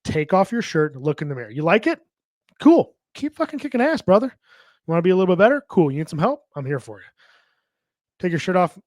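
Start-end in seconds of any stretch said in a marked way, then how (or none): 2.6-5.9 much higher and louder, fired-up cheer; hyped coach encouragement. Pretend. Coach cheering on a friend who likes his body. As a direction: hype your buddy up. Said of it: "pumping up the guys".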